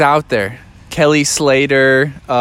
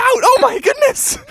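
much higher, startled exclaim; shocked gasp. A narrator's reading. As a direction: gasp as he wipes out. Narrator gasps at wipeout.

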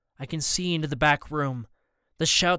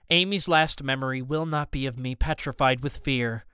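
ordinary delivery, nothing marked

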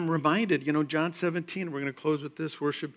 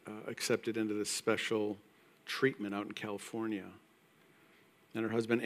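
usual speaking voice

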